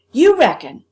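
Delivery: loud, scornful echo; mocking cook. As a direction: throw his words back at him. Cook mocks boy's words.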